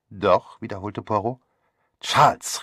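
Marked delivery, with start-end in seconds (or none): 2.0-2.6 a louder call of his name. Acted. Theresa calling out to her brother across the room, louder. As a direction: call Charles sharply, a little louder. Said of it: "rief Theresa".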